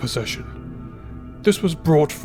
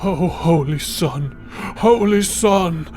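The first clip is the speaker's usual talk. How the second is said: slow cry rising higher on the repeat; horrified cry; 5 semitones higher, slower than usual. Acted. Priest receiving his slain leader's relic, crying out. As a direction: wail it in mounting panic. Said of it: "cried Ernon".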